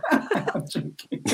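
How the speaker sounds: words lost in laughter; helpless, quiet laughing speech. Real, not acted. Laughs while teasing friend.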